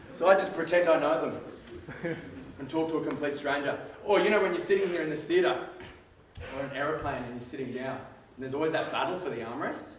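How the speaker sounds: normal talking voice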